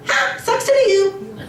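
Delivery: high mocking sing-song; taunting. Phone gloating at its owner, high mocking taunt.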